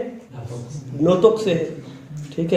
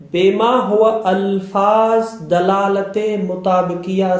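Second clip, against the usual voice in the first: « بے ما ہوا الفاظ » dit très lentement, mot à mot; slow dictation pace; much slower than usual. Shown as a demonstration. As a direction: spell it out slowly so students can note it. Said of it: "یہ بات، یہ بات رکھیں".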